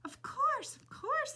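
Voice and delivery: put-on high, slow, sing-song old woman; old lady voice. A narrator's reading. Old lady welcoming Flora at the door, high and slow. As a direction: croon it like a sweet old lady. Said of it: "said the old woman".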